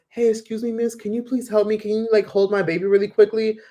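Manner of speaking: high sweet pleading woman, imitated; scam mother. Woman asking a tourist to hold her baby, high and sweet. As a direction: coax a stranger sweetly into helping. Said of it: "a woman walks up to you and says".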